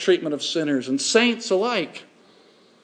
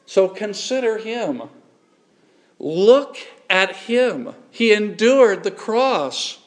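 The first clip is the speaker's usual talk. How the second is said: louder, higher preaching, slowing on key words; preacher urging the church to consider Christ, rising and louder; fervent preaching; 6 semitones higher, 5 dB louder than usual. Acted. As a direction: drive it home to the congregation. Urging listeners to look to Christ.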